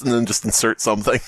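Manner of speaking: ordinary talk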